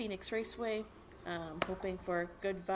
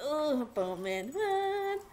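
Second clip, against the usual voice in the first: high, loud delighted outburst; excited squeal; 9 semitones higher, 5 dB louder, slower than usual. Real, not acted. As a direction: burst out with sudden joy. Thrilled by Bowman news.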